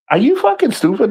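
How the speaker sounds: high-pitched mock disbelief; incredulous retort. Retelling his friend's reply to him, incredulous.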